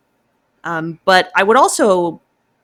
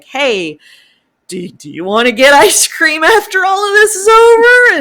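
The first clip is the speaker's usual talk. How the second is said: higher and louder, a bright eager invitation; playful pilot invite; 10 semitones higher, 8 dB louder than usual. Retold or imitated. Pilot cheerfully asking her partner out for ice cream, higher and louder. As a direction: pitch it up like a pilot keen for ice cream after the fight. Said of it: "They're like".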